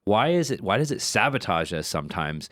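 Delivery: normal talking voice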